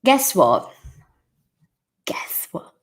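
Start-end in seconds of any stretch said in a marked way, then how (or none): 2.1-2.7 breathy secret whisper. Shown as a demonstration. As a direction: lean in and whisper it. Repeats expression in whisper.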